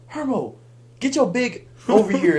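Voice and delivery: high, loud mock summons; hollered call, acted out; retold yelling. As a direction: imitate a friend yelling him over. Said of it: "all I hear is yelling behind me".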